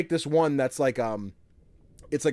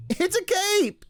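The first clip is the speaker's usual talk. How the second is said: put-on very high proud kid; little kid voice; much slower than usual. Small son defending his cape to mom, very high kid voice. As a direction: squeak it like a small boy.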